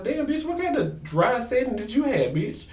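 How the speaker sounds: high-pitched, hammed-up disbelief; mock incredulity. Teasing Rashida over her sweating, high mock disbelief. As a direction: play the scandalized onlooker, voice way up.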